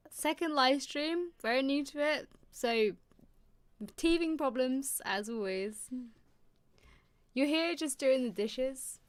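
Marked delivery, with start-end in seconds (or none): none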